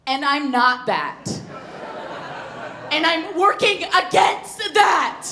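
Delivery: emphatic explaining. Retold or imitated. Explaining identity to mom.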